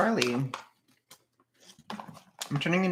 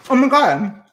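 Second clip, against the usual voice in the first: much higher and louder Oh my God; shocked exclamation; 12 semitones higher, 11 dB louder than usual. Real, not acted. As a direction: blurt it out in real shock. Sudden realization mid-stream.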